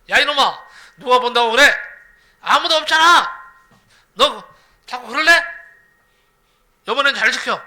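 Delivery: loud, scolding father; angry father. Retold or imitated. Angry father scolding his son for false alarms, loud scold. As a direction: bark at the boy to keep watch. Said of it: "화가 난 아버지가요".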